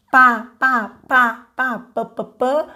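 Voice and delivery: "Pá, pá" as short crisp repeated syllables; sound of P. Shown as a demonstration. Teacher demonstrating the P sound for parents, crisp "pá". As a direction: tap out "pá" again and again. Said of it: "é o do P".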